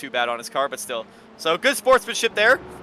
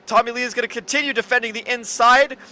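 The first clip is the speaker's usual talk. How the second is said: raised, louder race call, building with the battle; excited play-by-play; 8 semitones higher, 6 dB louder than usual. Real, not acted. Commentator calling a wheel-to-wheel overtake attempt, excited play-by-play. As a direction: drive the commentary up as the fight heats up.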